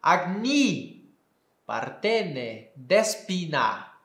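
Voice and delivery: slow Koine Greek reading, agni with i. Shown as a demonstration. Teacher reading a Greek line in Koine pronunciation. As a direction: read it the Koine way, slowly. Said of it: "Seria mais ou menos assim".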